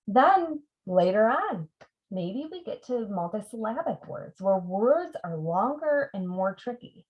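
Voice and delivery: ordinary talk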